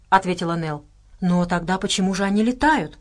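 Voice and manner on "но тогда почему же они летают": plain reading voice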